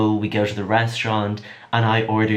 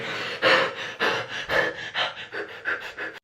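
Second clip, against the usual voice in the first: long breathy sigh of disbelief; long unvoiced sigh; 6 dB quieter than usual. Real, not acted. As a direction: let all the air out, overwhelmed. Reaction after brief greeting.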